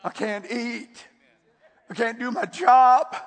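loud, high, slowed desperate cry; desperate shout. Retold or imitated. Husband crying out to the preacher that he can't cope, building to a shout. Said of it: "he was talking like that".

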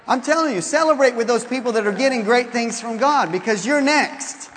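fervent sermon exhortation; preaching. Real, not acted. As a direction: press it on the congregation. Preaching at full force.

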